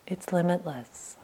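the second limitless dropped to a hush; quiet, awed echo; softly repeated. Shown as a demonstration. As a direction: let it fade almost to nothing.

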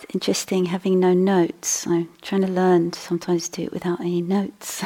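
normal talking voice

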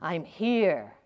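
understated voice from above; slow, lightly booming announcement; booming heavenly voice. Acted. Voice from the heavens answering the man, mildly booming. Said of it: "his voice booms out".

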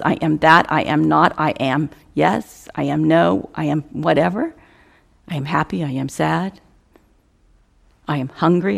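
ordinary talk, nothing marked

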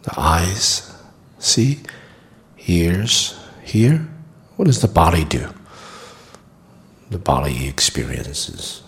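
calm meditation guide with long pauses; guided meditation. Acted. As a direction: guide them gently through the body, leaving long silences.

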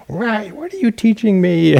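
high mock-outraged student; indignant student. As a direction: barge in and confront the teacher. Student storming in at teachers.

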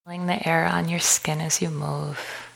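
calm, unhurried meditation guide with long pauses; guided meditation. Acted. Leads a walking meditation.

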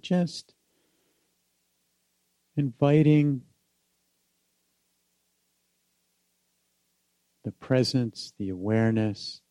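unhurried meditation guide with long pauses; guided meditation. Acted. Teacher inviting listeners back home in the body, guided meditation.

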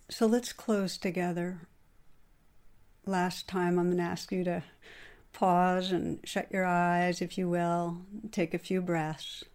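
soft, unhurried closing meditation voice; gentle guided pause with breaths; guided meditation close. Acted. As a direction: ease the room into stillness. Leads closing breathing moment.

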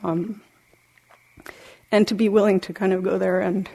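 normal talking voice

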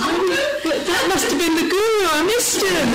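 very high, loud comic exclamation; astonished realisation. Retold or imitated. Pilgrim realising too late that was the guru, astonished wail. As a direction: cry out in sudden, amused dismay.

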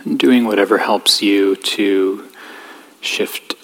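calm, even voice with long pauses; guided meditation. Real, not acted. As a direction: gently guide them inward, leaving space between phrases.

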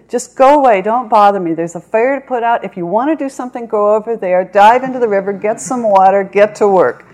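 loud, high commands; fierce parrot orders. A narrator's reading. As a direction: bark orders at the hawk, fire is burning. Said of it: "he said".

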